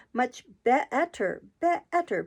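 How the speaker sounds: slow, climbing squeak on be-etter; rising into a squeak. A narrator's reading. Queen answering Alice, voice rising into a squeak. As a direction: let the voice slide up into a squeak. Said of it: "her voice rising into a squeak".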